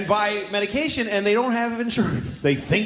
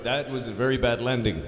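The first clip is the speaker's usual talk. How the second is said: low, lightly voiced cabbie; imitating a blunt cab driver; 9 semitones lower, 3 dB quieter than usual. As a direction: deliver a deadpan verdict, pitched down. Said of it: "the cab driver goes".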